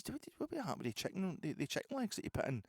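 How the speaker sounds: squeaky high spider voice, cocky; talking spider. Pretend. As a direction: play the spider sneering at the big man. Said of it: "He says".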